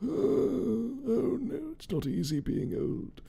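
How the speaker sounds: put-on high, soft old-lady lament. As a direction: put on a frail little old woman's voice. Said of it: "hobbling around like an old lady".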